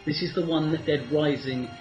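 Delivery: ordinary delivery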